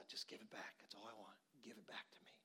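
soft whisper of a gentle God; hushed God voice. Retold or imitated. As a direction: breathe it out, almost no voice. God speaks gently in the story.

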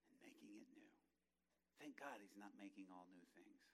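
soft, low, whispered reverence; closing the sermon in a reverent whisper; hushed preaching. Real, not acted. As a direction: bring them down to a sacred hush.

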